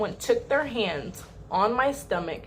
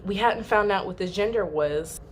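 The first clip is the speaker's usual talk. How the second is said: ordinary talk, nothing marked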